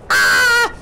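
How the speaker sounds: high hammed-up storybook scream; mock panicked scream. A narrator's reading. Disciples in the storm, hammed-up scream. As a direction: scream like frightened sailors. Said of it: "They screamed".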